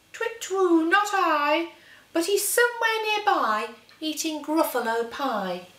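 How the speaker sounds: high hooting owl. Acted. The owl answering the Gruffalo's child, high hooting voice. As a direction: hoot it out like an owl.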